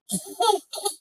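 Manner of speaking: high burst of laughter. Real, not acted. Laughing at the threat.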